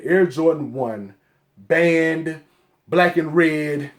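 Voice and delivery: ordinary delivery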